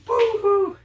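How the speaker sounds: high fake boo-hoo; mock crying. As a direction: tease her with a high fake sob. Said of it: "we're like".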